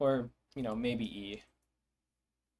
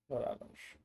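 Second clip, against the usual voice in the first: whispered retry; 7 dB quieter than usual. Shown as a demonstration. Testing the made-up word Vraloj again, in a whisper.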